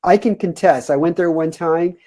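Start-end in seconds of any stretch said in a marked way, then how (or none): none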